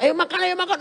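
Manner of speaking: high, loud coaxing call to eat; friendly invitation. Retold or imitated. A friend calls the pendoa to come eat, high and coaxing.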